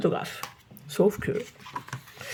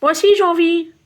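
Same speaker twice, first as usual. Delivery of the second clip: put-on higher and louder, a child chiming in; eager child; 8 semitones higher, 6 dB louder than usual. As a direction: jump in like an eager kid. Eudes enchaîne. (Eudes qui renchérit, voix d'enfant aiguë)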